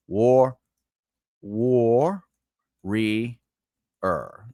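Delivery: slow syllable-by-syllable war, war, re, er; teacher chunking syllables; split into syllables. Shown as a demonstration. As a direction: break it apart beat by beat. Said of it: "When you say it fast".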